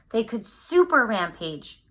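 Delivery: usual speaking voice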